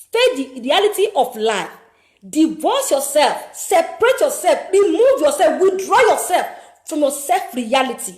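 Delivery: loud, forceful sermon voice; impassioned preaching. Acted. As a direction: preach it hard to the gamblers.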